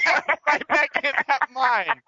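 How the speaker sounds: high laugh through words. Real, not acted. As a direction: laugh while echoing it. Laughing repeat of joke.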